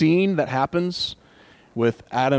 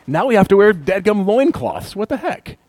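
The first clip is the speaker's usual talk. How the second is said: higher, exasperated complaint; mock indignation; 6 semitones higher, 4 dB louder than usual. Preacher mock complaining about loincloths, higher and exasperated. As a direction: grumble in mock outrage.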